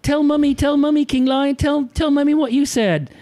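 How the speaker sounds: put-on high pleading little girl, tugging for attention; excited child. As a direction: insist eagerly like a thrilled kid. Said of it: "she says".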